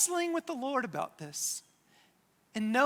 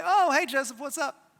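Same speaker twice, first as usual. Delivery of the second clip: raised, mock-breezy hello; joking casual greeting; 4 semitones higher, 3 dB louder than usual. Brothers answer long-lost Joseph, comically casual. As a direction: play it breezy for comedy. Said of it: "not getting any of my humor".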